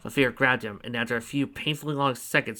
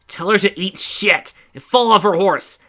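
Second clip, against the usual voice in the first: higher, louder rude retort; loud insult; 7 semitones higher, 9 dB louder than usual. Acted. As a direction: bark out the insult. Fafnir relays a rude retort.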